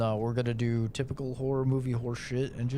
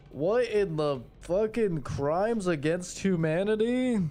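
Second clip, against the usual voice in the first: scared horror game streamer; 7 semitones higher, slower than usual. Real, not acted. Reacting to gruesome horror scene.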